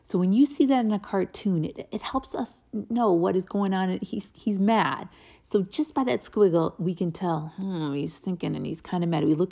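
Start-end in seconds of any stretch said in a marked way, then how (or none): none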